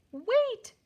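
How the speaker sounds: high, loud squeaky cry; tiny mouse shouting a warning. Acted. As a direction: squeak it out like a frantic little mouse. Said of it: "squeaked Mister Mouse".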